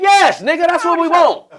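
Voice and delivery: loud high crowd answer; mock heckler; heckler yell. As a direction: shout it back at the stage.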